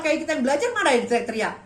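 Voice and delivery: ordinary talk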